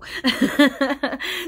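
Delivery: loud high laugh; burst of laughter. Real, not acted. Excited laugh after sudden prompt.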